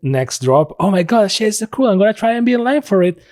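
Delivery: hyped up about the new drop, getting giddier; excited fan. Retold or imitated. Mocking hyped players.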